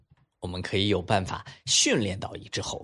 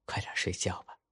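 hushed mother; 8 dB quieter than usual. A narrator's reading. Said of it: "妈妈说".

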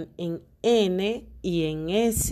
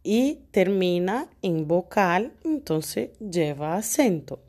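ordinary delivery

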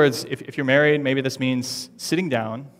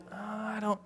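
soft, higher, hesitant refusal; reluctant hesitation; 6 semitones higher, 14 dB quieter than usual. Retold or imitated. A reluctant giver hesitating to let go, mock reluctant. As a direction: hold back the gift with a reluctant little voice.

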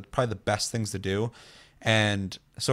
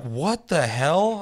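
baffled; 6 semitones higher, 4 dB louder, much slower than usual. Retold or imitated. Baffled by strange player.

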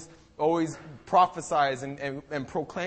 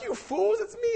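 comic exasperated Jesus. Acted. Jesus answering the frightened disciples, comic exasperation. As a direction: squeak it out as a playful scolding.

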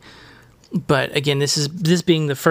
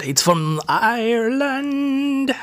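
high, slow put-on voice on "Ireland"; playful high sing-song on "It's from Ireland"; hammed-up repeat; 9 semitones higher, much slower than usual. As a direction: drag out "Ireland" high and silly.

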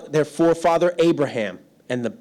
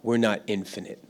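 Joshua reminding; 6 semitones lower, 6 dB quieter than usual. Retold or imitated. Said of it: "like Joshua was reminding them".

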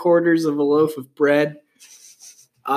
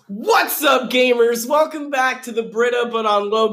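hype intro shout; 7 semitones higher, 3 dB louder than usual. Acted.

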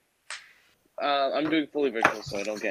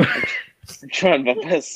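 drawn out plea; 5 semitones higher, 4 dB louder, much slower than usual. Real, not acted.

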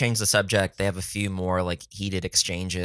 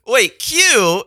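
sharp, high, louder disbelief; surprised; 8 dB louder than usual. Retold or imitated. Hearing the old woman mention Q, shocked and loud. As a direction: snap it out in sudden disbelief. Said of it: "I was like".

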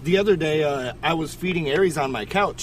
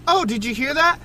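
put-on higher-pitched little kid voice; imitating little daughter; 8 semitones higher than usual. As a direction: mimic an excited toddler. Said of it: "she goes".

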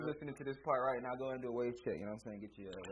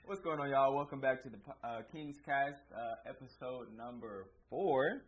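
podcast intro; 5 dB louder, slower than usual. Acted. Podcast host opening.

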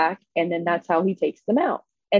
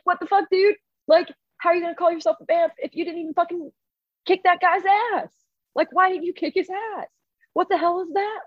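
high-pitched mock indignant villagers; imitated high, incredulous complaining; outraged townspeople; 10 semitones higher than usual. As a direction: scold him in a squeaky, disbelieving crowd voice. Townspeople berate the fighter, acted.

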